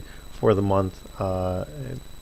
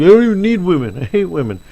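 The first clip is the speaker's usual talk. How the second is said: higher, louder hammed-up rant; mock bitter outburst; 8 semitones higher, 5 dB louder, faster than usual. Heartbroken Dracula swears off women, mock loud rant. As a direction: vent like a jilted guy, overdone.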